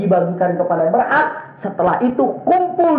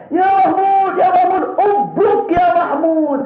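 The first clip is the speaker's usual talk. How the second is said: shouted call; 11 semitones higher, slower than usual. Retold or imitated. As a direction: command the elephant to kneel.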